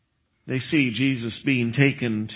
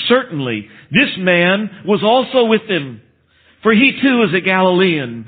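insistent accusation; 6 semitones higher, 7 dB louder than usual. Retold or imitated. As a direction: insist he is one of them, out loud. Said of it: "still another insisted".

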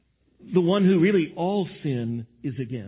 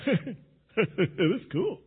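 smug slow cool; 5 dB quieter, much slower than usual. Retold or imitated. Sinner proud of his folly.

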